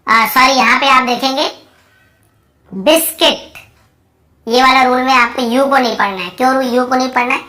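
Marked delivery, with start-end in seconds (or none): 2.7-3.6 "बिस्किट" as bis-kit, with no u sound; two crisp syllables, bis-kit, for "बिस्किट"; model pronunciation. Shown as a demonstration. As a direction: say "बिस्किट" distinctly, higher, as a model word. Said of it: "हम क्या पढ़ रहे हैं".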